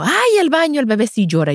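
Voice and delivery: sudden admission. Retold or imitated. Mother finally admits the bath trouble, high and sudden. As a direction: blurt it out as it dawns on her.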